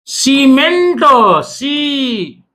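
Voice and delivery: सीमेंट with a long held सी, then सी alone. Shown as a demonstration. Teacher isolating the vowel, long held सी. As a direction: stretch the सी in सीमेंट. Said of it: "तो ऐसे में ये ई का होता".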